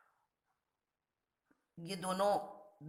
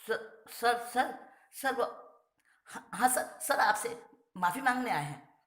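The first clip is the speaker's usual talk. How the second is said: nervous stammer; 7 semitones higher than usual. Acted. The boys plead with the sir.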